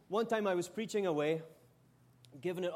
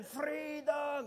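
a higher shouted try at the Scottish freedom roar, not quite right; attempted Scottish yell; 8 semitones higher than usual. Shown as a demonstration. Preacher retries the Scottish shout for the crowd, mock yell. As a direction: give the roar another go. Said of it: "Do that again".

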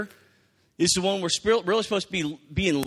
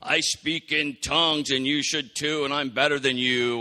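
a lightly voiced, smug show-off, louder; hammed-up bragging about spiritual gifts; mock boastful believer; 2 semitones lower, 4 dB louder than usual. Preacher mocking a boastful believer, hammed-up smug voice. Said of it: "We wouldn't walk around going".

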